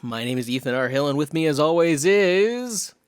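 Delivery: big punchy announcer opening; podcast show intro. Real, not acted. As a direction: belt out the greeting to the audience.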